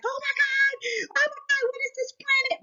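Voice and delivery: high, panicked gasp of shock; startled high-pitched panic at the sky; mock panic. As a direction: squeal it like a frightened onlooker. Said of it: "everybody's gonna be like".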